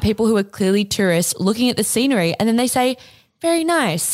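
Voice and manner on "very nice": put-on American accent. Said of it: "in, like, an American accent".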